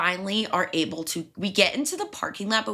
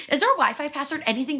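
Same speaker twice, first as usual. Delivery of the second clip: pushy, pleading question, pitched higher; demanding Karen; 8 semitones higher, faster than usual. Retold or imitated. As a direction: press the kid like an impatient customer. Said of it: "being a raging Karen".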